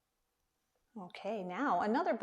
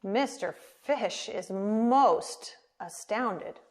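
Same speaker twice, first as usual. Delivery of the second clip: ordinary delivery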